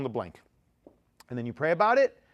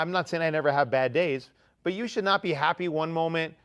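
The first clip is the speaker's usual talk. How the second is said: usual speaking voice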